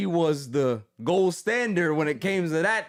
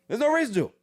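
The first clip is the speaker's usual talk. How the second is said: louder, higher insistence; emphatic repeat; 11 semitones higher, 7 dB louder, much faster than usual. Real, not acted. Refusing to ever visit Mississippi, said louder. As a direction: hammer it home the second time.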